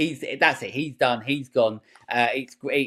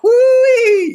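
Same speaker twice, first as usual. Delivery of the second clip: a wild high Southern woo-wee, much louder; hammed-up Southern whoop; 11 dB louder than usual. Host mocking the over-the-top Southern accent, shrill loud whoop. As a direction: whoop it like a cartoon Southern yokel. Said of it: "that proper Southern accent".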